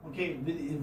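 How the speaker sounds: ordinary delivery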